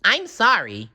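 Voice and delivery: high polite refusal; apologetic; SpongeBob voice. A narrator's reading. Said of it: "replied SpongeBob".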